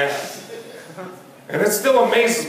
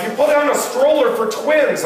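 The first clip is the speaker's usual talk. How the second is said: wife's complaint; 9 semitones higher than usual. Retold or imitated.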